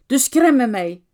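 sharp, loud angry snap at him; hissing snap. A narrator's reading. Wife snapping at her husband while the village burns, angry and loud. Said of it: "fräste jag till honom".

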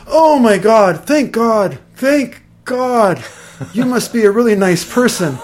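put-on high, slow, relieved old lady voice; old woman relieved. Eighty-year-old neighbour thanks the stranger, high and relieved. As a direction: play an elderly woman hobbling up, relieved. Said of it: "out comes this eighty-year-old woman".

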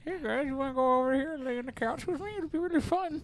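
high, squeaky mock-seductive voice; Meatwad. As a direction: coax them in a small cartoon squeak. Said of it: "sexy voice is Meatwad".

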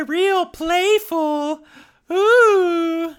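high, breathy-free sultry floozy voice, drawn out; brassy gum smacking floozy. Acted. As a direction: purr it like a flirty dame. Voicing seductive character in cartoon.

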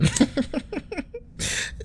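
airy squeal of laughter; high wheezing laugh. Real, not acted. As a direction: silent high giggle.

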